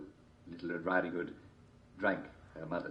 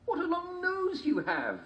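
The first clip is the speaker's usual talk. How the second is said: put-on slow girl voice; slower than usual. A narrator's reading.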